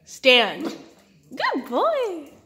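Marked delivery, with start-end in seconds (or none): 1.3-2.3 high, loud praise for a dog. Real, not acted. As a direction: gush over the dog, way up high. Praising dog after trick.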